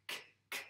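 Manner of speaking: "k" as a bare voiceless click; isolated k sound. Shown as a demonstration. Teacher isolating the sound for a pupil, bare "k". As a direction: say "k" alone, no vowel after it. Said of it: "le son k".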